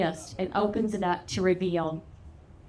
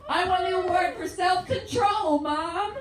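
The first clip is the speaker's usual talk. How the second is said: higher-pitched mock plea from Dad; Daddy imitation; 6 semitones higher than usual. Husband asking Mom for his own award, Daddy imitation. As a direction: whine it lightly as the aggrieved husband. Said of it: "Daddy goes".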